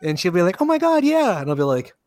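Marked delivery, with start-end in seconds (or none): 0.6-1.4 high-pitched imitation of gushing agreement; mock excited co-host. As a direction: put on an oblivious, eager co-host voice. Acting out co-host's cheerful reply.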